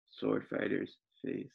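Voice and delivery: usual speaking voice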